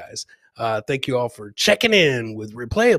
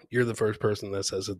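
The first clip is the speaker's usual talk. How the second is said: ordinary talk, nothing marked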